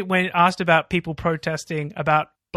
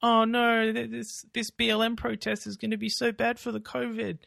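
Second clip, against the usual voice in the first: mock concern; 6 semitones higher, 6 dB quieter than usual. Mocking hypocrites' quote.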